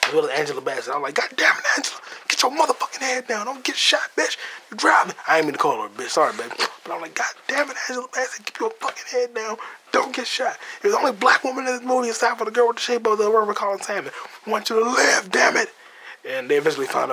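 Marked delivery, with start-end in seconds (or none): 1.2-5.1 yelling at screen. Retold or imitated. Yelling at Angela Bassett on screen. 7.1-15.7 pleading at screen. Retold or imitated. Yelling at Angela Bassett on screen.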